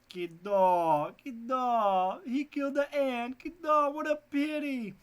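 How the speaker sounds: high loud mock lament; mock the Portuguese video wail; video impression. Teacher imitates the Portuguese video, high mock lament. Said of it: "Do you remember that?".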